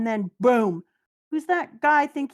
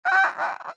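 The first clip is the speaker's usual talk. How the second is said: very high giddy laugh; giddy squeal laugh. Real, not acted. Fan laughing with joy at the return, high squeal. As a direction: let out a delighted squeal. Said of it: "laugh after cheer".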